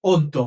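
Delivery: অদ্য said as "oddo", an o vowel and a doubled d; correct pronunciation. Shown as a demonstration. Teacher models for students: অদ্য as "oddo" with a doubled d. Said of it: "সঠিক উচ্চারণ হলো".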